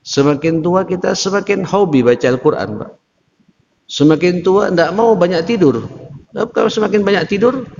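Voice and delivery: rousing sermon refrain. Acted. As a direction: drive the refrain home to the congregation. Preacher builds on repeated refrain.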